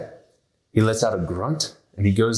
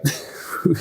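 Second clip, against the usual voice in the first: high laugh; 6 semitones higher than usual. Real, not acted. Laughing at memory.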